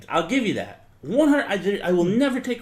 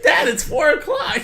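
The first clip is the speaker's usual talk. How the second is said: put-on high-pitched kid voice, half laughing; protesting child; 9 semitones higher, 4 dB louder than usual. Child objecting to an early bedtime, high mock kid voice. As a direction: pipe up like a puzzled kid. Plays the child answering the parent.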